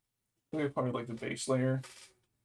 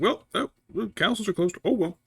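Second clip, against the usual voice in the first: higher, louder shrugging imitation; mock father-in-law; 9 semitones higher, 6 dB louder than usual. Imitating the father-in-law about the closed castles, higher and careless. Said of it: "And it's like".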